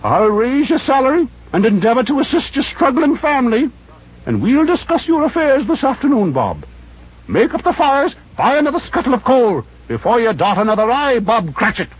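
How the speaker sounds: put-on high old man voice, sincere; mean every word, high and old; earnest old Scrooge. Scrooge cheering Bob, high earnest old voice. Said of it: "with an earnestness".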